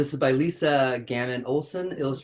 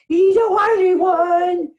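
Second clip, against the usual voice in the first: much higher, louder and slower; excited squeal; 16 dB louder, slower than usual. Real, not acted. Delights in the dog, squealing much higher and louder.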